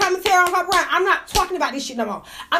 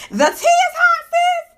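much higher, shouted hype over hot gossip; excited shout; 12 semitones higher, slower than usual. Real, not acted. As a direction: belt it like juicy news. Hyping up gossip to viewers.

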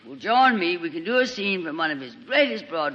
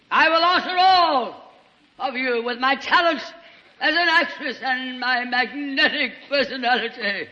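grand diva boast; 6 semitones higher than usual. Acted. Boasting to the gang, a grand theatrical diva brag. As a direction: declaim it like a vain stage star. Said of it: "This will show you whether I can act".

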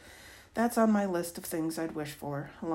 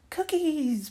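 put-on high, loud toddler squeal; little girl's echo; 11 semitones higher, 6 dB louder than usual. A narrator's reading. Little sister repeating her sister's word, high eager pipe. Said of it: "Penny repeats".

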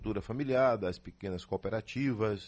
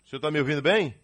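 to someone hard of hearing; 4 semitones higher, 5 dB louder, much faster than usual. Real, not acted. Bad phone line, checking audio.

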